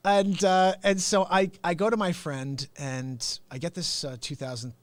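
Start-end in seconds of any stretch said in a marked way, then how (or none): none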